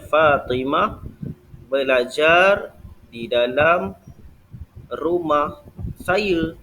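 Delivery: very slow dictation, word by word; slow example sentence. Shown as a demonstration. (ayat contoh dibaca perlahan-lahan)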